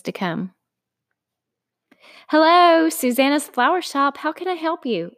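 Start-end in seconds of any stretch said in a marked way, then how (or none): 2.3-5.1 bright, eager phone greeting; chirpy and keen, like a child telling Santa; enthusiastic. Acted. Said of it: "with all the enthusiasm of a child".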